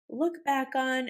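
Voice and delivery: normal talking voice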